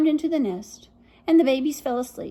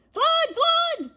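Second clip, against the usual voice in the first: very high alarm shout; cat crying flood. Acted. Jack to the birds, shouting a false flood alarm high. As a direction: fake a panicked warning. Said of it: "he cried".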